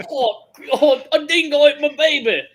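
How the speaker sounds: high, loud, hammed-up Australian accent; loud mock Australian accent. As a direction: ham up the dingo line like an Aussie. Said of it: "we got our Australian out".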